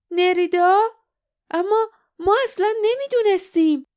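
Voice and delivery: high-pitched, stunned disbelief; astonished. A narrator's reading. Lif learning Nerida is the thief, astonished. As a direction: react in shock, pitch jumping up. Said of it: "لیف از تعجب نفسش بند آمد".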